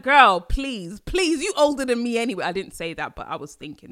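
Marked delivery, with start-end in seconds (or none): 0.0-2.4 sassy retort. Retold or imitated. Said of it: "I was like".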